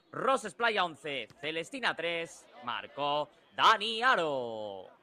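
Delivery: slow, drawn-out triumphant score; stretched score call. Real, not acted. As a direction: savor each name of the score. Score announced after the goal.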